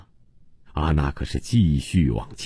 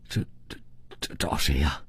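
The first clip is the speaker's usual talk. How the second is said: hushed, halting stammer; timid, faltering question; bewildered stammer; 2 semitones lower, 9 dB quieter than usual. A narrator's reading. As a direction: stumble softly over the first word, at a loss. Husband stammers back at fierce demand.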